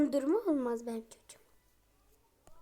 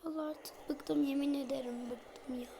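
weary whisper; 10 dB quieter than usual. Real, not acted. Exhausted by crying baby.

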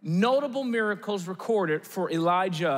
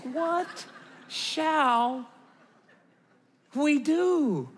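high, drawn-out helpless question; mock lament; 4 semitones higher, much slower than usual. Fans when Timberwolves make bad trades, hammed-up dismay. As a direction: stretch each word in comic despair.

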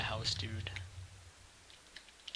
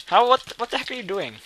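higher, louder startled what; shocked outcry; 8 semitones higher, 9 dB louder than usual. Real, not acted. Gamer ambushed by his rival, shocked outcry. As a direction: caught off guard. Suddenly attacked.

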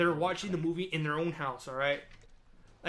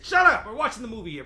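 loud high shout; imagined yell; 4 semitones higher, 9 dB louder than usual. Pretend. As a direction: blast it at the talker behind you. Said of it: "yell at the person".

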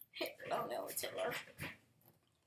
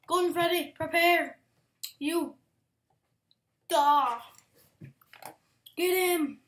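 loud, mock-heroic shout; battle cry; 2 semitones higher, 9 dB louder than usual. Player challenging Golden Freddy, hammed-up battle shout. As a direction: yell the challenge like a warrior.

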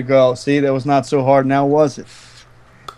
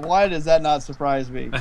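usual speaking voice

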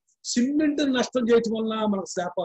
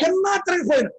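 high, impassioned pulpit cry; preaching exclamation; 8 semitones higher than usual. Real, not acted. Preacher tells of joy whether fed or not, exclaiming. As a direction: lift it high for the congregation.

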